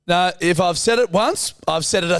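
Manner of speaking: wag a finger like a tired dad; nagging dad. Acted. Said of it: "the type of the father".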